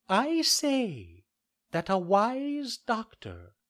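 a tiny insect scolding the doctors; talking cricket. Acted.

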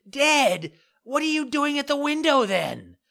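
very high, loud disbelieving cry; shocked squeal of disbelief; astonished. Acted. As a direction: puppet shocked by the girl's words, high and loud. Pinocchio astonished at her reply.

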